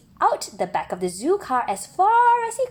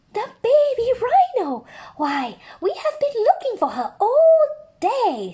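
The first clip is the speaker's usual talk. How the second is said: much higher and louder with surprise; higher, excited zookeeper voice; delighted zookeeper; 13 semitones higher, 5 dB louder than usual. Acted. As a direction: zookeeper overjoyed to spot the lost rhino. Zookeeper finds the rhino.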